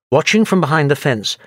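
plain reading voice

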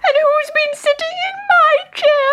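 put-on very high squeaky baby bear squeal; tiny bear cub squeaking in dismay. A narrator's reading. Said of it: "squealed Baby Bear".

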